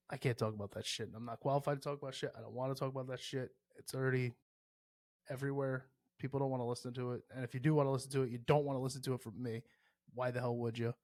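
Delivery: ordinary talk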